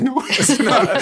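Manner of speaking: high, loud protest through laughter; mock protest. Mimics partner's laughing contradiction.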